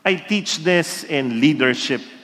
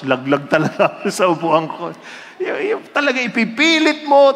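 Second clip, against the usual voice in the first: laughing; 4 semitones higher than usual. Real, not acted.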